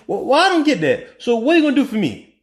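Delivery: put-on indignant questioning; acted voter's objection. Acts out left-out middle-class voter objecting.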